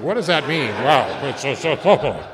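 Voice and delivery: loud, exaggerated bewilderment; mock bafflement. Reader puzzling over the twelve concepts, loudly baffled. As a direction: blurt it out, overwhelmed and baffled.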